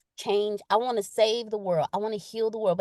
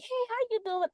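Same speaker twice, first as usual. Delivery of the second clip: high, light throwaway greeting; generic greeting; 11 semitones higher, 6 dB quieter than usual. Acted. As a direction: toss off a breezy, generic hello. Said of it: "how we do that generic".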